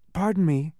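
apologetic little interruption; polite interruption. A narrator's reading. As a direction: break in politely. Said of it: "coughed Milo as politely as possible".

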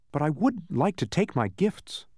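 high-pitched insistence; insistent. A narrator's reading. Milo asking for his gifts, insistent. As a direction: press the wish politely but firmly. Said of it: "Milo insisted".

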